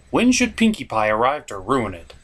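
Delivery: normal talking voice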